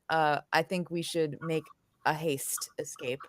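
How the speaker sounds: usual speaking voice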